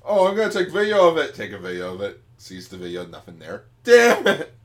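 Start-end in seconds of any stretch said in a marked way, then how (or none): 0.0-1.3 higher, louder, rushed excitement; eager excited. Acted. Eager bystander acted. 3.8-4.5 much higher and louder angry curse; frustrated yell. Acted. Frustrated exclamation acted.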